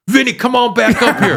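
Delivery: high, loud laughing holler; Tracy Morgan call-out. Retold or imitated. Headliner calling Vinny back up, high and laughing.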